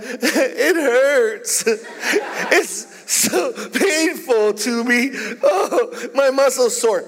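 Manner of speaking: hammed-up crybaby whining about sore muscles; whiny crybaby. Preacher mocks a whiny gym-goer as a crybaby.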